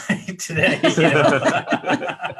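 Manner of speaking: words breaking into a real laugh. Real, not acted. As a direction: laugh through the end of your own joke. Joking about household whiteboard signals.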